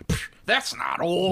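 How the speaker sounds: high, drawn-out mock dismissal; scoffing listener. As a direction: scoff as an offended forty-year-old, stretched out. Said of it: "forty-year-olds listening to this going like".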